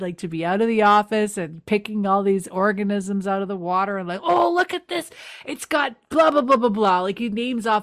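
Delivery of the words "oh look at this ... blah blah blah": higher, excited rattling off; mock excited nerd; excited geek. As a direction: gush like a thrilled scientist. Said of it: "he's all excited".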